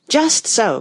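high, loud exclamation; cried. A narrator's reading. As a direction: cry it out in agreement. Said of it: "cried the Red Queen".

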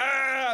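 high, loud mock scream; retold frustrated scream. Victim on phone to case desk, mock frustrated scream.

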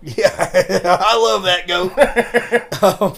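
loud, high, laughing delivery; cracking up at a running joke; real laughter. Real, not acted. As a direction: say it loudly through real laughter. Laughing at the misheard goat joke.

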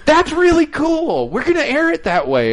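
hammed-up delighted praise; gushing email. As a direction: read the email like a thrilled fan. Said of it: "little email that comes out that says".

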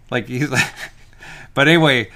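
ordinary talk, nothing marked

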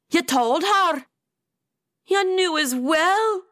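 high-pitched indignant questions; indignant shriek. A narrator's reading. Atia learning her friends knew, indignant shriek. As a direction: rise in outraged disbelief, short of screaming. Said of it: "Atia shrieked indignantly".

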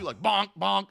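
two hammer hits on metal, voiced; cartoon bonks. Host imitates the cartoon hammer noises, bonk bonk. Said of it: "hammer hitting metal noise".

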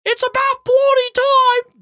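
very high, loud, slow cartoon squawk; impatient little ghost, piercing and drawn out; Joe's ghost. A narrator's reading. Joe's ghost finally gets his money, high slow squawk. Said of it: "Joe said. I can do that one".

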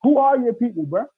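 high, loud, heated retelling of a confrontation; lost temper, retold. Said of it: "that's the only time I lost my temper".

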